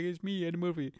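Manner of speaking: comedian impression, softly done; Eddie Murphy. Shown as a demonstration. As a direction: put on a famous comic's voice. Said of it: "he's doing that Eddie Murphy voice".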